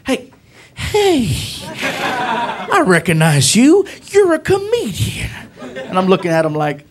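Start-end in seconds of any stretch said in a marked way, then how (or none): 0.1-5.3 excited cop. Retold or imitated. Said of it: "He's like".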